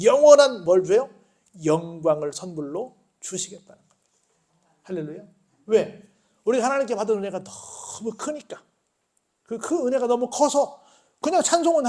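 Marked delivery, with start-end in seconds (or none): none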